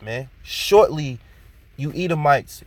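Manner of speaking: usual speaking voice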